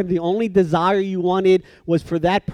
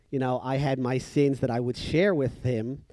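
ordinary delivery

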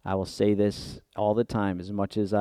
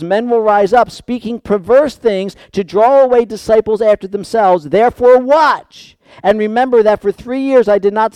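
high, forceful pulpit delivery; impassioned preaching; 10 semitones higher, 8 dB louder than usual. Real, not acted. Preacher recounting Paul's warning to the Ephesus church, impassioned preaching. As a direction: press each phrase home with raised voice.

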